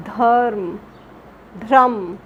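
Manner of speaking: धर्म said clearly, the र heard before म; careful pronunciation. Shown as a demonstration. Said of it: "ध्वनि हमारे मुख से निकलेगी". (धर्म को साफ़ उच्चारण के साथ)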